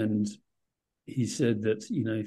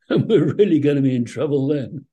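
amused; 3 semitones higher, 7 dB louder than usual. Real, not acted. Joking warning, laughs round it.

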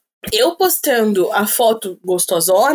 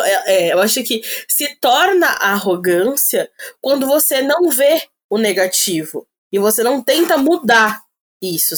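normal talking voice

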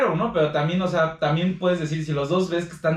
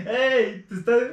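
high, breathless laughing fit; can barely talk from laughing; helpless laughter; 8 semitones higher, slower than usual. Real, not acted. Cracking up with friends over the joke, laughing fit.

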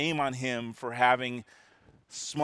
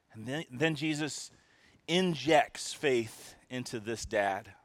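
ordinary delivery

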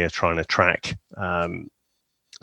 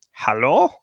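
tentative German hello, rising; German accent; 4 semitones higher than usual. Retold or imitated. German coastguard answers the radio, German accent. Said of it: "the German guy's like".

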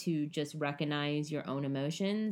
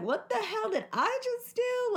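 much higher, baffled squeak; stunned disbelief. Retold or imitated. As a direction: play the shocked victim. The other person's stunned reaction.

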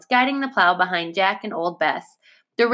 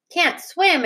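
mild exclamation; 8 semitones higher than usual. A narrator's reading. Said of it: "exclaimed Sarah".

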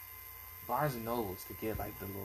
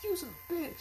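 mock screaming; 4 dB quieter than usual. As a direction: imitate a screaming girl in a raised, high voice. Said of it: "She's screaming at the top of her lungs".

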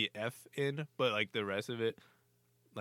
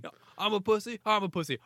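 much higher, louder shouted insult; mock screaming; 11 semitones higher, 9 dB louder than usual. Player screaming at a rival, mock shout. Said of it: "it's like".

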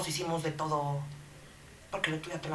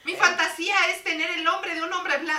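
put-on much higher, loud girly voice; 12 semitones higher, 12 dB louder than usual. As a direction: squeak it like a giddy girl. Confession sketch line.

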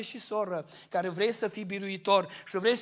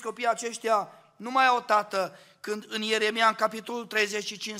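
ordinary delivery

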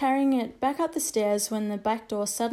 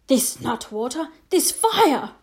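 pained outburst; 3 semitones higher, 5 dB louder, slower than usual. A narrator's reading. Stinging wound.

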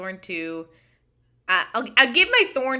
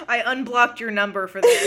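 laughing; 3 semitones higher, 8 dB louder than usual. Retold or imitated. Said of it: "she's like".

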